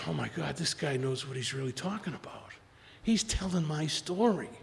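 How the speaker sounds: soft, awed murmur fading to a whisper; hushed realisation. Acted. Bob hearing his own story from Bill, hushed awe. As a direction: say it under your breath, amazed.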